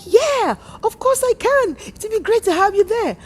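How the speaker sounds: friend's delighted welcome, voiced high; eager yes. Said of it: "And she's like".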